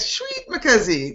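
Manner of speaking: high-pitched Afrikaans-accent impression; Mike. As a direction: show how a buddy says it, Afrikaans accent. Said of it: "That's what Mike always says".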